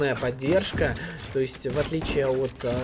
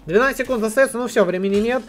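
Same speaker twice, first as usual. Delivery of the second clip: excited; 7 semitones higher, 5 dB louder, faster than usual. Real, not acted. Caster calls the round clock.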